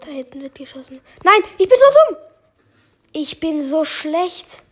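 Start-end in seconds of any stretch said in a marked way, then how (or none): 1.2-2.2 high, loud yell at himself; real frustrated outburst; frustrated cry. Real, not acted. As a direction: shout out in self-annoyance. Self-blame outburst in game.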